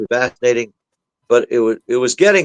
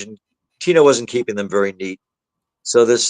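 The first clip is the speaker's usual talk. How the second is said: usual speaking voice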